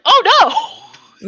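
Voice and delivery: loud high mock dismay. Listener retells her shocked reaction to the gunshot. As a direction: cry out in shock. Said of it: "I was like".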